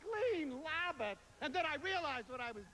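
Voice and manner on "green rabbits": put-on slow Italian accent, Gleen Labbits with l for r; mock Italian accent, drawn out. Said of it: "stupid Biscaglia says".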